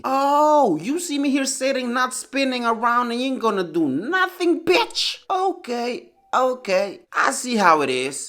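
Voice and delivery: high-pitched, mocking little-sister voice; sassy little sister. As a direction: squeak out a bratty challenge. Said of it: "gave me another look as to say".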